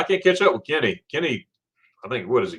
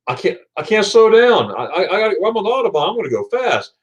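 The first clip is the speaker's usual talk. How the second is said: raised, insistent retort; retold insistent protest; 6 semitones higher, 3 dB louder than usual. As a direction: play back your own stubborn reply, pitched up. Acts out his defiant reply to friend.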